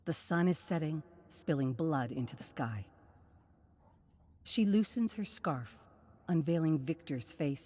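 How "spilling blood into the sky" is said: plain reading voice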